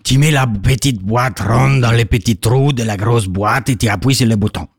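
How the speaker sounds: put-on deep, steady Italian accent; low Italian inventor voice. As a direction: Leonardo voice, low and even. Leo speaking in a put-on Italian-tinged voice.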